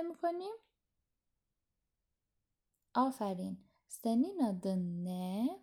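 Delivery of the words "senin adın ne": very slow, every syllable of "Senin adın ne" drawn out; slow teacher demo of "Senin adın ne"; slow, careful model pronunciation. Shown as a demonstration. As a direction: spell out "Senin adın ne" slowly for learners. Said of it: "پس آیشه میگه سنین آدن نه".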